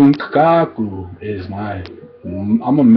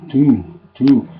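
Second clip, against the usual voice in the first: normal talking voice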